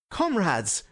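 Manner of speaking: high, mildly anguished appeal; martyr's cry. A narrator's reading. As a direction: cry out as if past pain. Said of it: "in a voice like that of a martyr".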